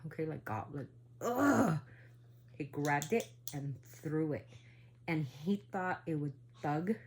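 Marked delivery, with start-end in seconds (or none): 1.2-1.8 a loud, higher groan of revulsion; disgusted ugh. Retold or imitated. Grandpa grabbing the demon child, disgusted groan.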